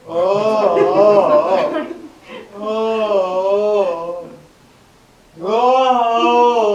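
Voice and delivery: big drawn-out yawning oh; a loud, contented stretching yawn. Shown as a demonstration. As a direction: yawn out loud, long and satisfied. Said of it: "you yawn".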